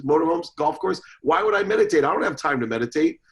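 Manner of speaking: loud, high, indignant rant; arrogant diatribe. Retold or imitated. Ranting at the doctor on the plane, loud and indignant. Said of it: "I literally went on a diatribe".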